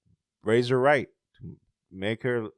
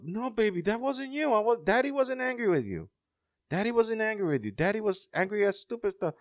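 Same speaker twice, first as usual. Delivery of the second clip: reassuring father; 10 semitones higher, faster than usual. Retold or imitated. Dad telling his daughter he wasn't angry, reassuring. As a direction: imitate a dad soothing his little girl. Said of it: "and he told her".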